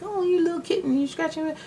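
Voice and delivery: high cooing pet voice; baby talk to a kitten. Retold or imitated. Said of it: "It's like".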